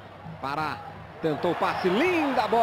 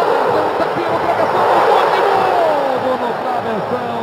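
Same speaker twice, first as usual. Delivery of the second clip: fast, loud rising alarm at the crossbar; excited commentary; 4 semitones higher, 9 dB louder than usual. Real, not acted. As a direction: call the near miss at full volume. Near-miss hits crossbar twice.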